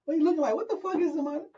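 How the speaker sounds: high, hyped-up mock disbelief; an excited TikTok reviewer, hammed up; imitating hype reaction. Retelling the reviewer's reaction to a song, hyped and high. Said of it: "he's like".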